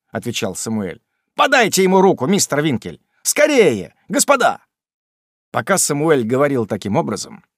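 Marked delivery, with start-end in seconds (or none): 1.3-4.6 hurrying shout. Acted. Sam hurrying the gentlemen.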